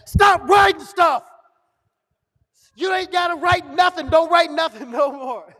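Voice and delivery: loud, high comic outburst; exasperated shout. Acted. Boss begging Marvin to stop writing, exasperated shouting. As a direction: yell it in hammed-up exasperation. Boss's outburst acted out on stage.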